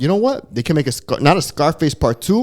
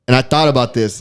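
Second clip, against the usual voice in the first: normal talking voice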